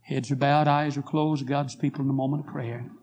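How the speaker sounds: normal talking voice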